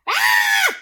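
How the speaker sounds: high, loud frightened squawk; startled squawk. Acted. Turkey squawks in fright at the moose. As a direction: yelp in fright and bolt.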